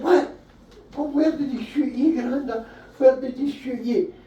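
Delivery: put-on breathless child begging for the war story; eager kid voice. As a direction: squeak it out like an excited wee kid. Comic voicing young self to granda.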